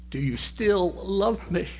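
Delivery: lightly voiced young girl, slow and pleading; small daughter's plea. Daughter begging her father, a hint of a slow small voice.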